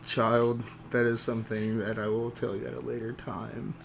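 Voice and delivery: low, hushed put-off; gently defer an awkward question, quietly; patient elder to child. Acted. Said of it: "I'm gonna lean down to Zero".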